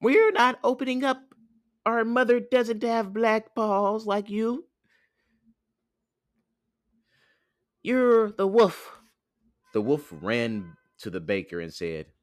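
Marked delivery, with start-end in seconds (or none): 0.0-9.0 very high, squeaky refusal; defiant little goat kids, getting loud; kids crying out. Acted. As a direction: squeal the accusation like a child. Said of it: "they cried out".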